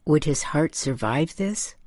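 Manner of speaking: usual speaking voice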